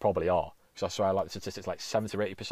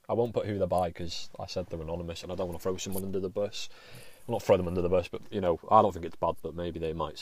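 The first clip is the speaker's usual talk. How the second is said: usual speaking voice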